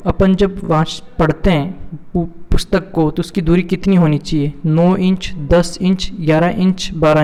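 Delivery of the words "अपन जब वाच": ordinary talk, nothing marked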